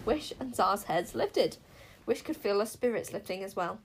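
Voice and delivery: ordinary delivery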